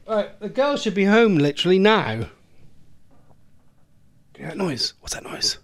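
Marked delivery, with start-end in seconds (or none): none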